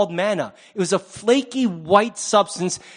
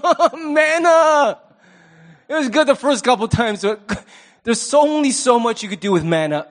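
laughing mock whine; 4 semitones higher, 3 dB louder than usual. Preacher mimics Israel tired of manna, laughing whine. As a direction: whine like sick of the same dinner, chuckling.

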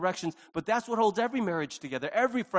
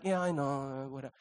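dismissive; 5 semitones lower, 5 dB quieter than usual. Pretend. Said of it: "And he's like".

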